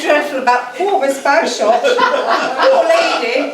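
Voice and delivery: lightly hammed-up posh English accent with laughs; a hint of an affected upper-class English accent; put-on posh English accent. Accomplice covering with guests, mild put-on posh English accent. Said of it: "that ridiculous accent".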